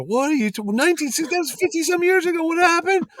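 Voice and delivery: very high-pitched, incredulous questioning; acting out baffled alarm, pitch climbing steeply; retold disbelief. Organizer reacting to a decades-old grudge, acted high incredulity. Retelling his own baffled reply.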